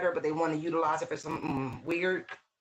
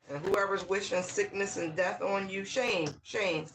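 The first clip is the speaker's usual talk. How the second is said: ordinary talk, nothing marked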